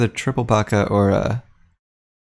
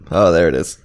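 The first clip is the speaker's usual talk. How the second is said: burp; 5 semitones lower, 3 dB louder than usual. Real, not acted. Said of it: "I've got the hiccups slash burps".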